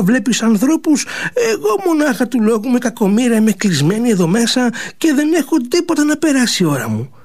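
lightly voiced plaintive girl; complaining sister. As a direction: sulk to your brother about being stuck inside.

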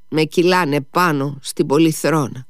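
plain reading voice

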